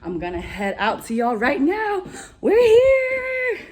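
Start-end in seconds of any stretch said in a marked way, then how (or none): 1.1-3.6 excited cry. Real, not acted. Arriving on stage to the crowd, thrilled. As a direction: announce it with triumph.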